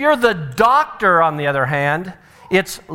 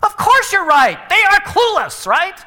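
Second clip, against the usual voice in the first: high, louder mock self-righteousness; smug parent; 5 dB louder than usual. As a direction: ham up the know-it-all parent. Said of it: "mock parental self-righteousness".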